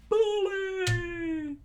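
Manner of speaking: high, loud, crying shout; crew's hammed-up weeping shout. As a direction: sob it out at the bully. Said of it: "crew started to weep and scream out".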